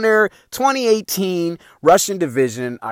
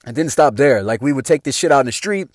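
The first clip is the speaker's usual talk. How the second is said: ordinary talk, nothing marked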